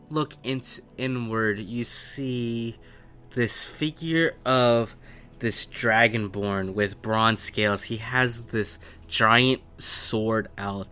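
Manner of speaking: ordinary talk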